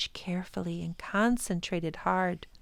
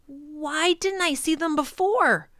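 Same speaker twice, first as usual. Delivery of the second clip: bewildered; 8 semitones higher, 6 dB louder than usual. Acted.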